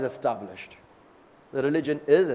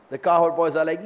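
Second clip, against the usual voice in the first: ordinary delivery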